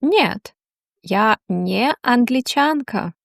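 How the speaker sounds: ordinary talk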